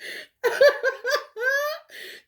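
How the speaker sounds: high cackling laugh. Real, not acted.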